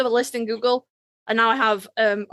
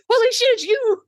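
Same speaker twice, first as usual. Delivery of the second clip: high, loud mock shock; stunned fan; 12 semitones higher, 4 dB louder than usual. Reader spotting the author online, high surprised yelp. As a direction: play the starstruck fan. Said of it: "And then they're like".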